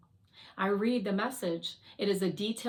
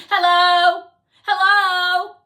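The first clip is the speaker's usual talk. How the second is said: high, full-volume yell; 10 semitones higher, 17 dB louder, slower than usual. A narrator's reading. Fly tries to get Courage talking, high loud yell. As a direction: shout to rouse someone unresponsive. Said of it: "then yells out".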